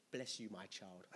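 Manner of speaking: quiet pretend benediction; mock saintly blessing. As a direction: bless them gently, tongue in cheek. Imagined gracious reply to queue jumper.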